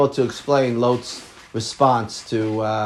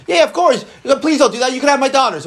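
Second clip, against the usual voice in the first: mock eager offer; 10 semitones higher than usual. Mock offer to angry mob.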